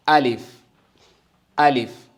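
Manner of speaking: alif with a long open a, loud and clear; alif said clearly. Shown as a demonstration. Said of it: "We pronounce this as alif".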